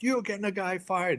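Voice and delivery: retold tipsy indignation; imitated accusation. Drunk friend accuses the actress, mock indignant. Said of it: "here's Johnny Mac yelling at him".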